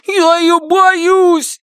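very slow, high fearful wail; frightened. Acted. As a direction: whimper it out in fear. Frightened boy repeats his fear.

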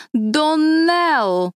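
Доннелл with the second syllable stretched high and loud; stress on last syllable. A narrator's reading. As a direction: land hard and long on the end of Доннелл. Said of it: "правильнее делать ударение на последнем".

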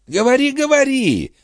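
high-pitched, jeering urging; mocking invitation. A narrator's reading. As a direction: egg him on mockingly, pitched well up. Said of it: "насмешливо пригласил он Био".

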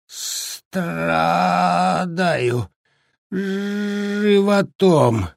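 slow, strained, high groan; groaning in pain. Acted. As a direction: squeeze the words out through pain. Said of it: "в два приёма с трудом выговорил".

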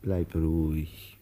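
slow, low calming words; soothing, slow reassurance. Acted. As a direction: settle him down slowly. Calming the agitated friend.

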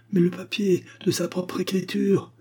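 high-pitched protest; agitated high exclamation; exclaiming. A narrator's reading. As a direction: cry out the objection. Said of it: "s'écria Bark".

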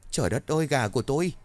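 viel höher, erschrockener Ausruf; fassungslos stammelnd. A narrator's reading. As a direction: vor Schock aufschreien, hoch. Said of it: "ông lắp bắp".

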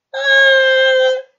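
high, loud sustained scream; hysterical scream. A narrator's reading. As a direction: scream out in panic. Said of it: "истерически вскрикнула орлица". (истерический крик)

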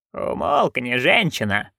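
ordinary delivery, nothing marked

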